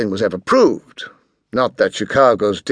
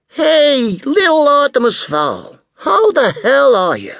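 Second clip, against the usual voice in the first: brash American tycoon; 3 dB louder than usual. A narrator's reading.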